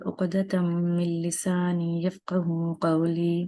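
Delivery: measured, recited opening prayer; formal Arabic invocation. Acted. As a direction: intone the blessing before the lesson.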